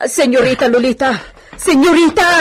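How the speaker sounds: loud alarmed call across the room. Acted. Maid calling her fallen mistress, loud. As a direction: cry out her name in alarm.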